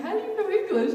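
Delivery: high, loud astonished question; stunned that she speaks English; amazed question. Retold or imitated. To the little girl, astonished high loud question. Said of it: "I was like".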